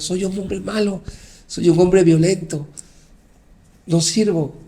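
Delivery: a half-voiced shout of despair, slowing; retold cry to God. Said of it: "le gritaba a Dios". (gritándole a Dios, a medio volumen)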